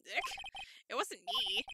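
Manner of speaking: normal talking voice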